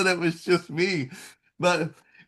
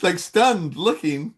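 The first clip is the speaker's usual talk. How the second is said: ordinary delivery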